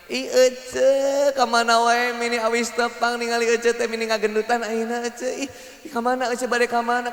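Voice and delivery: imitated sugary women's greeting; sweet to the face. Woman meeting a woman friend, exaggeratedly sweet. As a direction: gush over a friend with put-on sweetness. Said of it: "wanita mah manis di depan".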